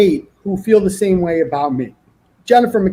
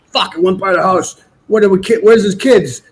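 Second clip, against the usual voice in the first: a hint of a frantic cop, a bit louder; panicked cops; 4 dB louder than usual. Cops realising they followed the wrong bus, lightly panicked. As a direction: play the flustered cop losing the kids, hurried.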